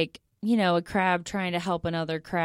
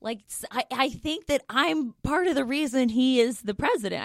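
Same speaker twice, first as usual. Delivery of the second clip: stoner; 4 semitones higher than usual. Pretend. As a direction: play it dreamy and far-out. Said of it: "gonna sound like a really high thought".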